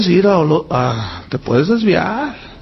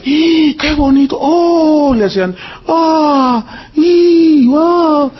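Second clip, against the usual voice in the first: awed tourists; 8 semitones higher, much slower than usual. Retold or imitated. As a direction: imitate people amazed at the scenery. Tourists marvelling at nature.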